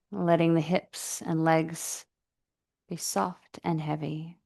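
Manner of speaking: calm, even meditation voice with long pauses; guided meditation. Real, not acted. Yoga teacher settling the class into breathing practice, guided meditation. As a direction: guide them gently into the breath, unhurried.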